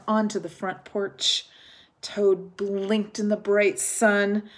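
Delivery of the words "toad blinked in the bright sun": usual speaking voice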